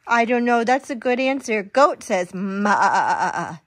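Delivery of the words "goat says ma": slow drawn-out reading. Acted. Reading goat sound.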